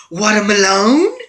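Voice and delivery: high, loud, drawn-out happy scream; excited scream. Retold or imitated. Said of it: "he screams out".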